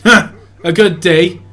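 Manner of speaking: loud scornful laugh, then a furious retort; bitter angry outburst. Acted. Husband scoffing loudly at his wife's question, angry. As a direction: spit it back at her, loud and scornful.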